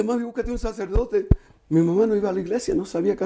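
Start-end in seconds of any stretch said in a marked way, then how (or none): 0.0-1.3 softer, higher-pitched imitated plea; retold pleading. Son begs his mother for a priest, retold pleading. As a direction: plead gently, re-enacting it. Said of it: "Y yo implorándole".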